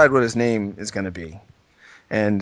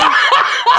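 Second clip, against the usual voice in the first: high laugh; 5 dB louder than usual. Real, not acted. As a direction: burst of very high squealing laughter. Cracking up after the bit.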